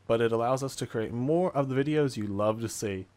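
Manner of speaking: loud, upbeat channel intro; YouTube intro. Acted. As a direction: hype up the episode like a host. Channel intro before the episode.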